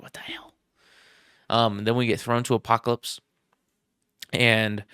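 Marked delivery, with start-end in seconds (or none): 0.0-0.5 breathy unvoiced whisper of disbelief; whispered reaction. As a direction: whisper it under your breath, stunned. Viewer's stunned reaction, whispered.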